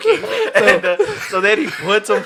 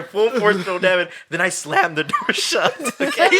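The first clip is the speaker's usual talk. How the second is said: normal talking voice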